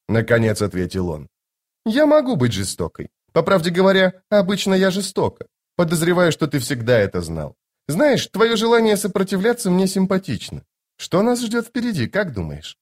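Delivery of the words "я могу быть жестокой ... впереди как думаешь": high-pitched lady, dropping at phrase ends; playfully confident noblewoman, voice lifted high; self-assured sorceress. Acted. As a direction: speak as a lofty, teasing enchantress. Voices Lady Envy in dialogue.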